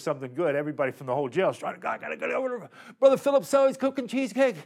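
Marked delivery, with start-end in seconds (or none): 1.8-4.6 high, fast, excited inmate; eager inmate voice. Retold or imitated. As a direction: rush the words like a hungry inmate.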